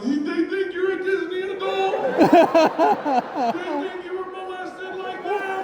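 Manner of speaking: high, mocking daughter's voice. Daughter teasing dad about Disney, high mocking voice. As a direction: squeak it out like a teasing kid.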